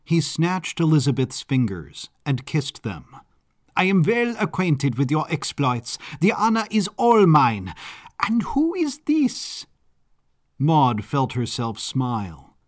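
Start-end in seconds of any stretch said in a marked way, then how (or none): 3.8-9.7 effusive Baron, pitch swooping up high; flamboyant Baron. Acted. Baron kissing a guest's fingers, flamboyant and effusive. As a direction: gush grandly, climbing higher to the question.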